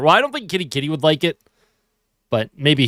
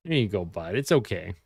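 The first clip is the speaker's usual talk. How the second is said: soothing cat; 6 semitones lower, 8 dB quieter than usual. Real, not acted. Settling cat on desk.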